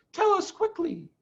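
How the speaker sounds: high impatient mother fox; Missus Fox urging. Acted. Mrs Fox urges Mr Fox, high. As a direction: press him to hurry. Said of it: "said Missus Fox".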